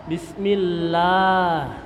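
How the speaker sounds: bismillah drawn out slowly with open teeth; teacher demonstrating bismillah with teeth open, slow; open-mouthed bismillah. Shown as a demonstration. Guru mengaji mencontohkan bismillah, slow and open. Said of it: "gigi dibuka".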